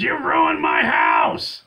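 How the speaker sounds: high, loud, drawn-out shriek; imitated scream. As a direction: scream it as the enraged woman, stretching the words. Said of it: "she just screams at him".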